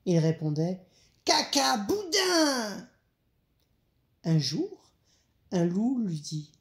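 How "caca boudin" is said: very high, stretched-out squeal; cheeky little rabbit. A narrator's reading. Little rabbit answering his big sister, cheeky and very high. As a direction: pipe it up high and draw out the end.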